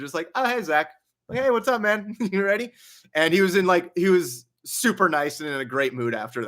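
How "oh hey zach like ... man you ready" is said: fake friendly. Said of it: "Obviously, it was fake".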